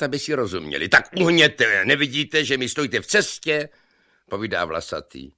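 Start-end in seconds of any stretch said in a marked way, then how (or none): 0.9-3.7 loud, high shout over the roaring stream; shouting over water. A narrator's reading. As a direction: yell at him to step aside. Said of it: "museli na sebe pěkně hulákat".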